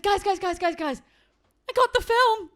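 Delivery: high, loud, bursting news; excited announcement. Retold or imitated. Telling his parents he got the film. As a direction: build up then burst out the news. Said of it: "I said".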